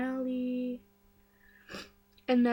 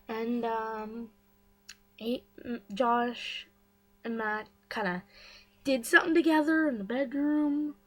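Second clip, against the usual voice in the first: normal talking voice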